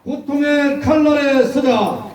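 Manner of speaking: slow, high roaring slogan; roaring rallying speech. Real, not acted. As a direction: roar each word to lead the crowd. Leader chants slogan, crowd repeats. (느리고 우렁찬 구호 외침)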